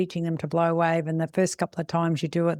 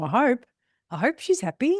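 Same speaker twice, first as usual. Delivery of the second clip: higher, worried inner voice; anxious hoping; 6 semitones higher than usual. Retold or imitated. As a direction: sound like a nervous owner hoping. Anxious owner wondering.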